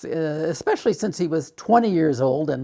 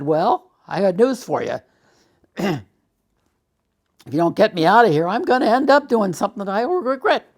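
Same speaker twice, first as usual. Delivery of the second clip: angry threat; 7 semitones higher, 7 dB louder than usual. Retold or imitated.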